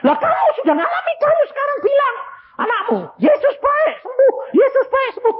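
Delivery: sangat tinggi dan keras, nyaris menangis; seruan berapi-api. Retold or imitated. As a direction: serukan dengan penuh semangat. Preacher exhorts mother, shouting. (menyuruh ibu berseru dengan suara tinggi dan keras)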